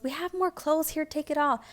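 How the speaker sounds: a hint of a kind lady pressing gifts on her; generous lady.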